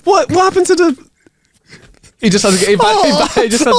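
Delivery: high, laughing "what happened"; laughing disbelief. Real, not acted. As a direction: giggle through the question.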